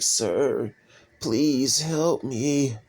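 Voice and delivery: slow, drawn-out mock plea for help; pleading starving stranger. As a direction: imitate a weakened beggar, stretching the words out. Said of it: "walking past people dehydrated, weak in the stomach".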